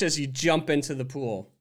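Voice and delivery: usual speaking voice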